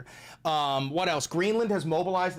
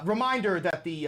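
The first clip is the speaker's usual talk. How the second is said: usual speaking voice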